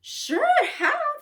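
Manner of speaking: bright squeaky yes; high excited reply. Acted. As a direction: jump way up high, eager. Wizard answers eagerly in story.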